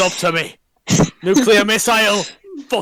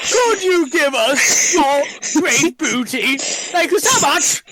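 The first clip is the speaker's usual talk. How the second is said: put-on posh English accent; 7 semitones higher than usual. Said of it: "posh English accent".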